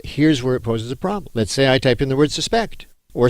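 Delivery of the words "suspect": "suspect" as suh-SPEKT, end stressed; verb pronunciation. Shown as a demonstration. Teacher typing an example word, sus-PECT. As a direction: stress the second syllable of "suspect". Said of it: "the other pronunciation of the word".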